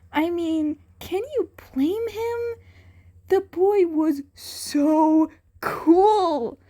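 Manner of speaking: high, slow, dreamy gushing; gushing fanboy. A narrator's reading. Narrator gushes over the cat boy, high and dreamy.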